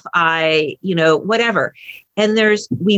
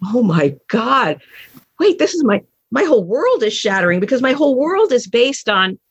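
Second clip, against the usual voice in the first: mind blown; 4 semitones higher than usual. Retold or imitated. Parent realising the son owes nothing, mind blown. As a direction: act out a stunned realisation, pitch climbing.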